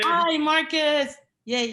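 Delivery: slow happy hello; excited greeting. Real, not acted. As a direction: greet him warmly as he pops back in. Greeting chef rejoining call.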